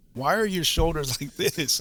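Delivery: a high, slow question broken by a laugh. Retold or imitated.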